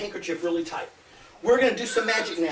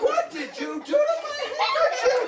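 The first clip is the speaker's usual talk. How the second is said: mock shock; 12 semitones higher, slower than usual. Magician's mock shock at trick.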